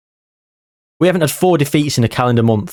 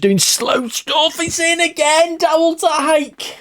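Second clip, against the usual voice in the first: tense excitement; slower than usual. Real, not acted. Tense late match, goal drama.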